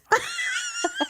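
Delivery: high, shrieking burst of laughter. Real, not acted. Shock laugh after the death.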